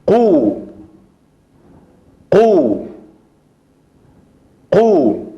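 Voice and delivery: sílaba qu repetida; syllable drill. Shown as a demonstration. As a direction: model qu. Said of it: "la voy a pronunciar".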